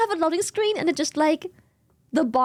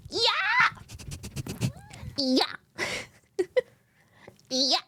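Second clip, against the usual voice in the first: German ja; 7 semitones higher, 4 dB quieter, slower than usual. Pretend. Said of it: "See German accent?".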